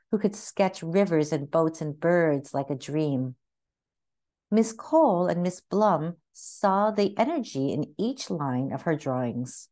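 usual speaking voice

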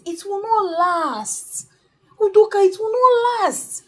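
slow warning rising into tears; tearful warning. Acted. Building warning, voice cracks.

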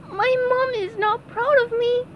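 crying high squeak; tearful sponge voice. Acted. SpongeBob breaks down crying about his mom.

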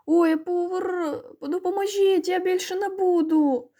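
high, drawn-out drowning wail; choking plea. A narrator's reading. Drowning Vasyl begs boys for help, choking wail. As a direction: wail and beg as if swallowing water. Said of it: "захлинався один голос".

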